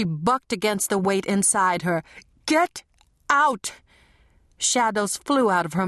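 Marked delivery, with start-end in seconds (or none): 2.5-3.7 screamed command. A narrator's reading. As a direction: scream it out with everything. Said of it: "Marie screamed".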